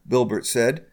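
plain reading voice

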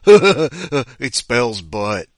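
laugh, then blurt it out loudly; hammed-up giggling delight at a silly word; gleeful laughing discovery. Inventor shaking cubes to see what comes up, laughing delight.

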